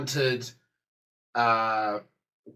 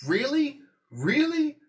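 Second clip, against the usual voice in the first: high, rising disbelieving question; sceptical, high-pitched questioning; incredulous; 11 semitones higher than usual. Retold or imitated. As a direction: ask it in pointed disbelief, pitch leaping up. Sceptical reaction to the models.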